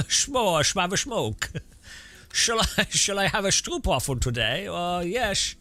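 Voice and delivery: put-on high, hammy Dutch accent with slushy s sounds; a bad Dutch accent, like Santa Claus having a stroke. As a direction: play a pompous Dutchman musing aloud in a Dutch accent. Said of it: "That's my Dutch accent".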